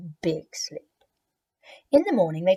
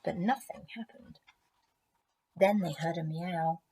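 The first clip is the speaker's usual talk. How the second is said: ordinary delivery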